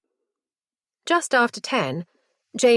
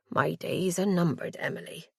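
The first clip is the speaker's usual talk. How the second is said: softly; 3 semitones lower, 7 dB quieter than usual. A narrator's reading. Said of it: "she says softly".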